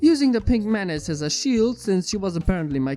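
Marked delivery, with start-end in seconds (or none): none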